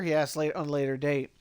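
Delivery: ordinary delivery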